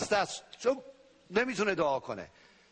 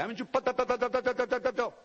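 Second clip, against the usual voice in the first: mock chatter; 6 semitones higher than usual. Mimicking endless chatter.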